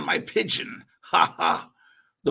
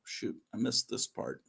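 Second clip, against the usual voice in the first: usual speaking voice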